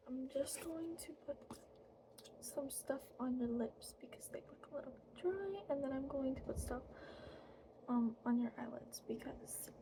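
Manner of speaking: soft ASMR whisper; fairy whisper. Acted. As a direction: whisper close and gentle.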